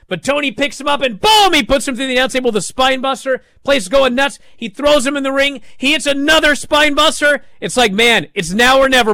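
high, loud rushing match call; real thrill reliving the match; excited play-by-play. Real, not acted. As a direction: call the finish like a commentator losing it.

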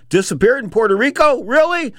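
high-pitched sceptical questioning; pointed disbelief, climbing very high; incredulous. Real, not acted. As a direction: echo the claim in rising disbelief. Host doubts witness just vanished.

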